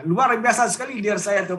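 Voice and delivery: ordinary talk, nothing marked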